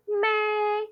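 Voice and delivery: "may" held long, high and level; first tone. Shown as a demonstration. Teacher reminding students of first tone, high steady "may". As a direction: sustain "may" on one high note. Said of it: "first tone".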